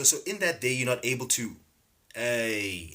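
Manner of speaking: ordinary talk, nothing marked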